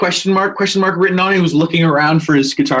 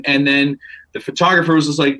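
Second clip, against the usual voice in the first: usual speaking voice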